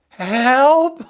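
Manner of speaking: high-pitched mock yell, laughing; retold yell for help. Stranded hiker yelling down to a boat, mock yell. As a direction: call down from far up, played for laughs. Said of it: "I yell down to the boat".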